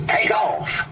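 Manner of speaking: high, breathless hurried command; mock-urgent getaway order. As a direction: bark it to get the car moving. Levon urgently orders driver away.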